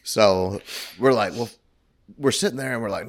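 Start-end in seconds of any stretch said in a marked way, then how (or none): none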